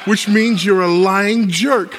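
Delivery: loud, high, drawn-out punchline; triumphant shouted accusation. Acted. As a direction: shout it slowly as the gotcha. Triumphant punchline after provoking the husband.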